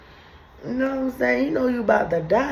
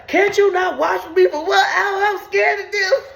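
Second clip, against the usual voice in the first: mock Jesus pleading; 8 semitones higher than usual. Jesus begging sleepy disciples to stay awake, high pleading whine. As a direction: whine it like a scared savior begging.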